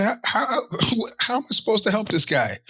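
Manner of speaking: high incredulous cry breaking into a laugh; baffled mock wail. Retold baffled quote.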